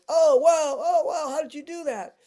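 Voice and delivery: put-on a kid marvelling at the vanished knot; amazed kids. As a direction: gasp it like a wide-eyed kid. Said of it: "the kids are going".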